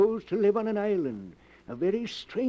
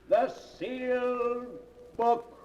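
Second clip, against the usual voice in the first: ominous title; 5 semitones higher, slower than usual. Acted. Host intones the title.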